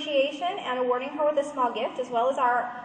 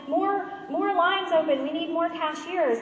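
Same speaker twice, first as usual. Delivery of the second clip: raised, higher urgent call; 5 semitones higher, 4 dB louder than usual. Retold or imitated. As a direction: call out for help over the store. Manager calling for more cashiers.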